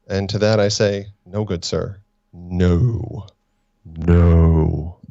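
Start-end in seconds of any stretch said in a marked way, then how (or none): 1.2-5.0 muffled voice through cupped hands; hands cupped over mic. Acted. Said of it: "me cupping my hands over the microphone".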